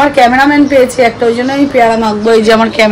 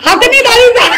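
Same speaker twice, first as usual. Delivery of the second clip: high scolding; 11 semitones higher than usual. Real, not acted. Scolds the girl over the plate.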